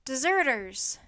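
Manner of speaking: chapter title called out loud; emphatic title. A narrator's reading. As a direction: announce the title forcefully.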